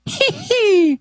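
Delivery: high, hammed-up studio laugh; mock sitcom laugh track. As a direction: burst into a canned laugh. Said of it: "having the Letterman laugh on drop".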